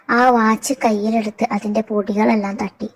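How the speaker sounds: plain reading voice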